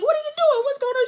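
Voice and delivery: hammed-up high-pitched panic; mock panicked shriek. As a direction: shriek it like a startled man being groped. [name] startled by grab.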